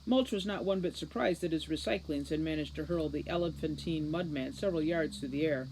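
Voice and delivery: ordinary delivery, nothing marked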